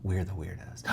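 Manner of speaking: whispered aside; hushed whisper. Real, not acted. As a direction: lean in and whisper. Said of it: "conspiratorial aside".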